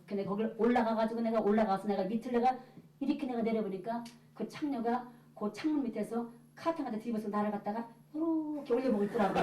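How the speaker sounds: normal talking voice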